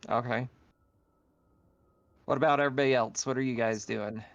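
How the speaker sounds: ordinary delivery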